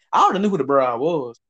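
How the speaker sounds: normal talking voice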